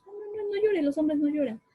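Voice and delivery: high, brushing-off parent voice; mock dismissive parent. Parent telling crying son to stop, high mock scolding.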